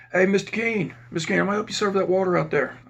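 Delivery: calling out. Retold or imitated. Calling out to officer down hall.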